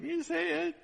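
high, squeaky tit-for-tat; mock childish retort. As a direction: mimic a squabbling kid. Father bickers back in kid voice.

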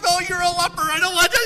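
high, loud squeamish protest; mock panicked refusal. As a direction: act out a panicky TV-character refusal. Monk refusing in panic, acted.

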